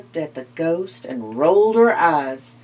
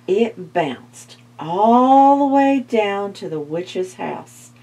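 plain reading voice

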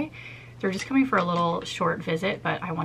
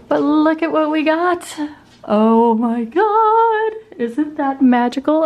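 loud, higher, thrilled; excited; 9 semitones higher, 12 dB louder, slower than usual. Real, not acted. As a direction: gush over the new lights. Showing new bathroom lights.

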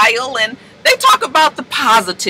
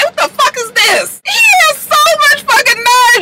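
high indignant shout; outraged; 14 semitones higher than usual. Real, not acted. Ranting at the cheater on the show, outraged shout. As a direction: yell it in disbelief at his nerve.

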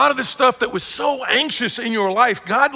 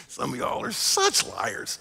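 teasing whisper; 3 semitones higher, 8 dB quieter, slower than usual. Real, not acted. Teasing congregation after show of hands.

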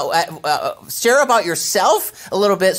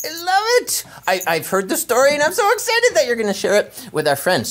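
ordinary delivery

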